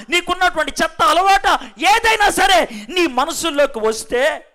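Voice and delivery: a fiery revival preacher naming every sin; preaching at full force. Acted. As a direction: hammer each sin home from the pulpit.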